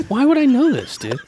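high, loud outraged whine; why would I know that; indignant protest. Real, not acted. Protesting, louder, higher.

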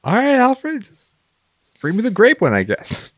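high, loud sulky demand; whiny Bruce Wayne. Pretend. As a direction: settle grudgingly for grape. Bruce Wayne replying to Alfred.

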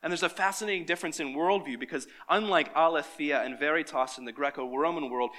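normal talking voice

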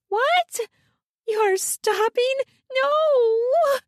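very high, drawn-out whine; whining. A narrator's reading. Woman protesting to her lover, whining. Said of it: "she whines".

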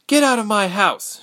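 high, loud shriek at intruders. A narrator's reading. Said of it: "she shrieked".

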